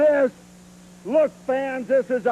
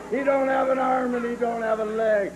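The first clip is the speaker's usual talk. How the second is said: normal talking voice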